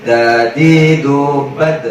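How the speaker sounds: Dadi dubad drawn out very slowly; slow drill. Shown as a demonstration. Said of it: "Coba, coba, dua, tiga".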